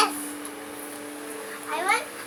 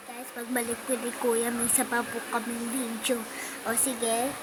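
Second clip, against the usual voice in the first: hushed aside; 10 semitones lower, 12 dB quieter than usual. Real, not acted. Aside to viewers.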